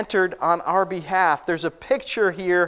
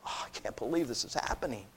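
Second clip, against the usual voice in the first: very soft, low awed aside; stunned disbelief; 8 semitones lower, 14 dB quieter, faster than usual. Acted. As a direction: imitate an open-mouthed onlooker, hushed. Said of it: "their mouths are just open".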